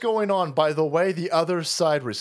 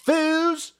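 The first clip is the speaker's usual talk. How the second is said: hype callout; 10 semitones higher than usual. Real, not acted.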